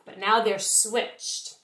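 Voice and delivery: usual speaking voice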